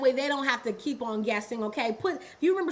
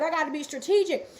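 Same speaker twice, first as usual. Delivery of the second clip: ordinary talk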